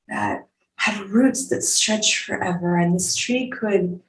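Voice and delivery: normal talking voice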